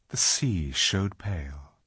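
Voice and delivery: plain reading voice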